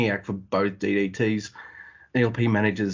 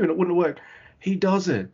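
ordinary delivery

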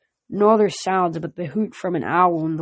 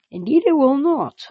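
smug villain; 8 semitones higher, 3 dB louder than usual. Acted. Villain general mocks goddess.

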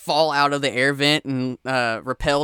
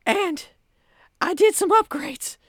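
grandiose villain monologue; 7 dB louder than usual. Acted. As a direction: gloat like a sci-fi mastermind. Villain monologue over intercom.

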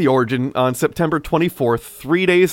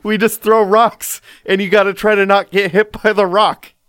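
put-on kid voice; 5 semitones higher, 3 dB louder than usual. Voicing a kid explaining.

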